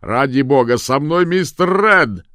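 high, loud plea; exasperated shouting. A narrator's reading. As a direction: holler it through a locked door. Shouting through the door.

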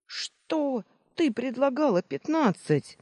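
high-pitched, incredulous protest; indignant disbelief. A narrator's reading. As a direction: object as if cheated in a bargain. Baker outraged during haggling.

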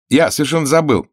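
high, loud hurried excuse; flustered, hurried correction; hasty. A narrator's reading. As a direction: blurt it out to cover a lie. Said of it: "поспешно сказал Пашка".

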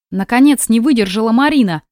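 plain reading voice

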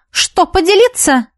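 high, goading offer; mock a rival with the question; taunting. A narrator's reading. Taunt in acted quarrel. (издевательски, с вызовом)

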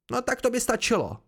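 high, indignant outburst; vzteklý výkřik. A narrator's reading. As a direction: call a halt angrily, pitch well up. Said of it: "vykřikla Kryn vztekle". (rozzlobené okřiknutí)

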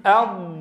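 teacher models a clear Arabic ض in "أض"; "أض" with the ض pressed from the tongue's edge against the molars; model sound. Shown as a demonstration. Teacher to students, modeling "أض" with ض from the tongue's edge. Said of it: "ابدأ معي بالضاد الساكنة وقل".

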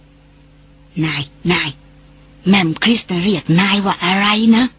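a hint of an old man leaning in to whisper; lightly voiced old villager asking slyly; mock whisper. A narrator's reading. Old man asks his boss in a lightly voiced whisper. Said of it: "ยื่นหน้ามากระซิบถามว่า".

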